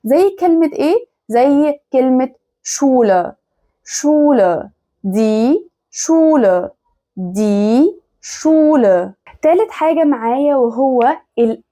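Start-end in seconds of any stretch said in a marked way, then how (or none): 2.6-9.1 "شولة" as Schule with plain sh and long u; ordinary sh. Shown as a demonstration. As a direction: slowly draw out "دي شولة" as die Schule. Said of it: "انطقوها شين عادية".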